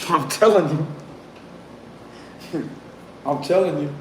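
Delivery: chuckling through the words; can't stop giggling, amused; laughing. Real, not acted. Reader cracking up at the cards.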